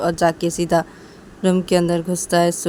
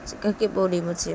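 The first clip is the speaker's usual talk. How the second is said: plain reading voice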